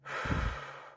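long breath blown out; audible release breath. Shown as a demonstration. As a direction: exhale fully and audibly. Exhale after the release phrase.

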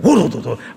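a loud revving motorbike rattle; imitated motorbike roar; motorcycle engine imitation. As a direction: mimic a bike roaring off. Motorcycle ride retold.

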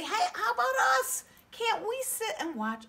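high, fast squeaky weasel; chirpy little puppet begging to stay. Pretend. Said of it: "Willie, they say".

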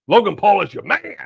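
loud, high-pitched needling; teasing. Pretend. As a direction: rib a friend, pushing the last word up. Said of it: "I'm just messing with you".

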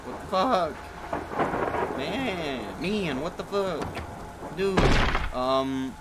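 slow stretched disbelief; drawn-out shock. Real, not acted. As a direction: drag it out, stunned.

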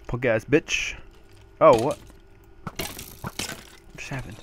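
ordinary talk

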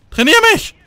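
high, loud excited yell; excited shout. Real, not acted. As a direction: shout it out in awe. Excited reaction while playing.

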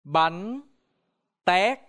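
"Bánh tét" spaced, tét high and loud; Vietnamese pronunciation. Shown as a demonstration. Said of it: "Now repeat".

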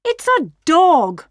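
high, loud mother wolf; scolding. A narrator's reading. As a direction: correct the son crossly, high up. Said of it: "scolded his mother".

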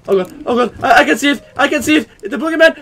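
loud, high panicked babble, building; rising panic. Real, not acted. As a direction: blurt it out as terror mounts. Panics on seeing the boogeyman.

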